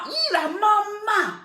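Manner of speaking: high, slow squeal of mock delight; mock joyful surprise. Mother mocks a young man thrilled she phoned, high squeal. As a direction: parody being thrilled mum called. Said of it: "c'est ta joie que tu manifestes comme ça".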